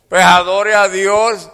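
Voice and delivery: loud, high, drawn-out command; forceful exhortation. Real, not acted. Preacher urging the sick to worship, forceful shout. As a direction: preach it out at full force, slowly.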